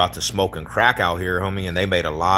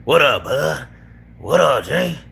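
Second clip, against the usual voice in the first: loud, high mock Denver homie greeting; hammed-up Denver "what up, bud"; 6 semitones higher, 4 dB louder than usual. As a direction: call it out like a Denver fool at a cookout. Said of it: "fools from Denver will be like".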